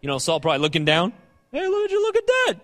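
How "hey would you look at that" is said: much higher, teasing Hey would you look at that; playful mock surprise. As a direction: tease Saul like showing off a trick. David playfully showing Saul the robe.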